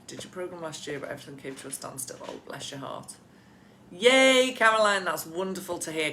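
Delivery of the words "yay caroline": a loud, high burst of joy; delighted cheer. Real, not acted. Coach cheering a client's wonderful news, loud delighted yay. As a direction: cheer her on like a proud coach.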